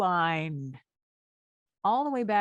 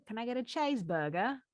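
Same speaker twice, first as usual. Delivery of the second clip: Australian accent; 4 semitones higher than usual. Shown as a demonstration. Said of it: "explain how to do an Australian accent".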